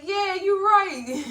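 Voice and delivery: high, loud, drawn-out mock agreement; amused hearty agreement. Host agreeing with therapy, amused mock agreement. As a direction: agree big, breaking into a laugh.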